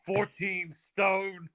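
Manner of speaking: high, slow, mocking trash-talk jab; hammed-up boxer trash talk, high and slow; mock Tyson Fury insult. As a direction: drag out the insult in a sneering high voice. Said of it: "The classiest of trash talks is Tyson Fury".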